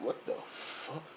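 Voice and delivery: hushed, slow disbelief; awed whisper. Retold or imitated. Tasting a great burger, an awed whisper. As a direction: breathe it out in wonder. Amazed at good food.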